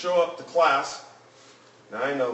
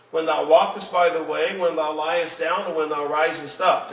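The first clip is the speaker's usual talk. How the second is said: firm, raised preacher's reading voice; scripture recitation; 4 semitones higher, 5 dB louder than usual. Acted. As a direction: declaim the verses with measured emphasis.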